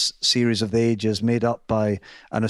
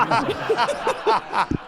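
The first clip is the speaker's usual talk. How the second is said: laughter. Real, not acted. Laughing at own story.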